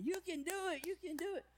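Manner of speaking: high, loud hammed-up cheer; rally everyone on like a pep squad; mock encouragement. Greeting team cheering people in the bathroom, mock encouragement.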